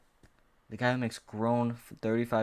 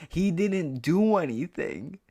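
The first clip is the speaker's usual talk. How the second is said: pitched way up, slow and louder, drawn out in disbelief; exasperated high whine; 8 semitones higher, 5 dB louder, much slower than usual. Real, not acted. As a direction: wail He didn't do anything like you can't believe it. Exasperated repeat of his point.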